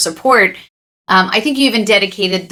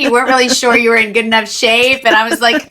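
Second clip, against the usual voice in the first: usual speaking voice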